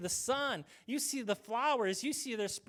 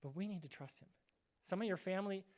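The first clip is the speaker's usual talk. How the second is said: ordinary delivery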